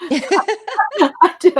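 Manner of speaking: laughing high and loud; amused admission. Real, not acted. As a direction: laugh while insisting. Laughing insistence.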